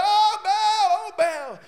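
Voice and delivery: high sung cry to Baal, imitating; hammed-up wail of pagan prophets; mock wailing prophets. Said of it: "they're screaming and hollering".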